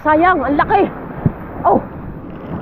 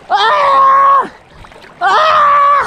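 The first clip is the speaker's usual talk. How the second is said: long high-pitched cry; high, drawn-out holler of excitement; excited scream; 9 semitones higher, 3 dB louder than usual. Real, not acted. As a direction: scream out over the catch. Exulting over the catch.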